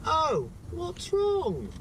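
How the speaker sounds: high, slow, sing-song concern; cheery shop worker. Retold or imitated. Cashier asking a grumpy customer, high sing-song. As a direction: mimic a chirpy cashier asking. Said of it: "he was like".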